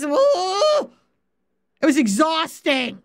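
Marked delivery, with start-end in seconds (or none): 0.0-0.9 exasperated groan. Retold or imitated. Recalling exhausting rambles.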